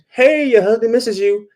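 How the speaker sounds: put-on high, pleading old man on voicemail; imitating a lovesick old husband; mock old husband. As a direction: put on a frail, doting old man's voice.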